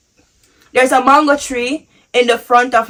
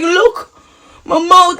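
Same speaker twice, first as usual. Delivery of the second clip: retold distress; 6 semitones higher, slower than usual. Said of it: "I'm crying and I'm like".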